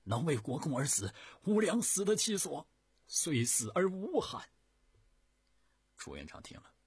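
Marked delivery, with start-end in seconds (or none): 0.0-4.5 loud, much higher vow, slowing at the end; stirred declaration. A narrator's reading. Wu Liang vowing to die for his lord, stirred and loud. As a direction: pour out devotion with fervour. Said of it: "吴良激动地说".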